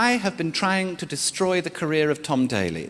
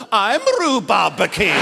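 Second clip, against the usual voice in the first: proud declaration; 3 semitones higher, 5 dB louder, slower than usual. Retold or imitated.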